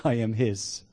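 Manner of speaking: usual speaking voice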